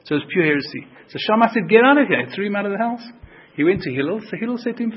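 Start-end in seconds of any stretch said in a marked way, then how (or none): 1.7-2.2 loud, higher shout; angry shout. Retold or imitated. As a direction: chase him out. Shammai throws heretic out.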